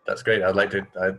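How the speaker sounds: usual speaking voice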